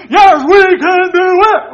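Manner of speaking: loud, high, slow cheering shout; crowd cheer. Retold or imitated. Soldiers answering the orator, loud high cheer. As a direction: roar it back like a fired-up army. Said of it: "everybody goes".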